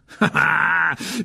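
high, loud triumphant laugh; gloating laugh. Acted. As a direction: laugh out with savage satisfaction. Mr Weasley gloats over his enemy. (rire triomphant)

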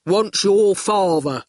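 slow, dull oaf; goon voice. A narrator's reading. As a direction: plod through it like a simpleton. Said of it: "said the goon".

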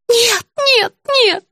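high, frantic denial; agitated old woman. A narrator's reading. Patient denying that lover helped her, agitated. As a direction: refuse again and again in a high voice.

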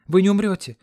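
high, loud insistence; insistent cry. A narrator's reading. Dayana insisting to dying Ron, loud. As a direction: refuse to let him die.

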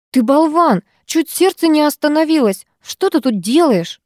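выше, напористо, с упрёком; отругай друга за испуг; сердито. Acted. Налетела на Роба с кулаками. (сердитый упрёк, голос выше)

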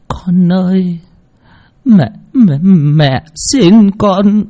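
put-on a weak old mother begging her son's wife; feeble old woman begging. A narrator's reading. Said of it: "miệng thều thào". (giọng bà lão yếu ớt van xin)

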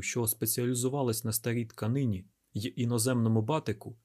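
usual speaking voice

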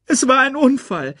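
put-on high, loud plaintive child; wailing protest. A narrator's reading. Simba defends himself to Scar, wailing. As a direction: plead innocence in a high wail. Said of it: "klagte Simba".

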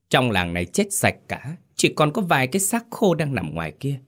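put-on high, sneering old woman's voice; a voiced greedy landlady, scornful; scheming wife. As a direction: sneer it down at him. Wicked wife scolding husband.

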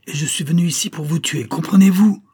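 high, building to a loud shout; furious threat. A narrator's reading. Stranger come to kill the major, furious shouted threat. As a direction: rise to a shouted demand at the end.

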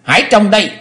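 high, loud battle shout. A narrator's reading. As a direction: bellow a challenge. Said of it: "thét lớn".

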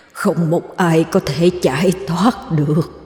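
slow, drawn-out eerie threat; menacing ghostly growl. A narrator's reading. Ghost warning that none can escape, slow menace. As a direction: let the curse drag out like a spirit. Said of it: "gằn giọng thì thầm".

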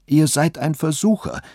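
high-pitched indignant outcry; exclaiming. A narrator's reading. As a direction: cry out the accusation, without shouting. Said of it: "rief sie aus".

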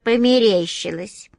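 ordinary delivery

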